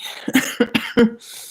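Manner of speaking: short airy laugh; breathy laugh. Real, not acted. Laughs after twenty twenty.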